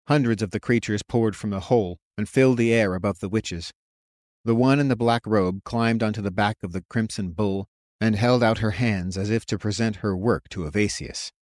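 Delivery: plain reading voice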